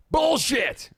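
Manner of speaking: a sudden loud high yell of "Bullshit!"; shouted outburst. Real, not acted. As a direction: bark it out, caught off guard. Outburst at his own claim.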